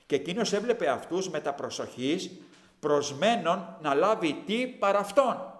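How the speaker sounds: loud, slow scripture preaching. Acted. Preacher reading Acts to the congregation, loud and slow. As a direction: declaim the verse slowly.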